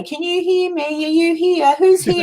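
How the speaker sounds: high, mocking séance questions; mock ghost hunter. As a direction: call out as if questioning spirits at a grave. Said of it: "sit on someone's grave and say".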